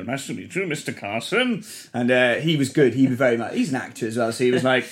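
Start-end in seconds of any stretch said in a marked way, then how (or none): 0.0-1.6 Jim Carter impression. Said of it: "just sort of did the impression for him".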